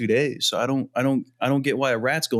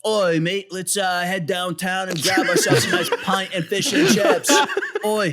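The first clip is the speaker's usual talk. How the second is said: higher, hammed-up Cockney English accent; 6 semitones higher than usual. Shown as a demonstration. Friends asked for his English accent, he hams up a higher Cockney voice. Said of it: "your English accent".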